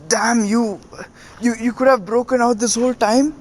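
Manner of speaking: imitating a cornered villain's shock; stunned outrage. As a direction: sound caught out and indignant. Wounded villain realizes the trick.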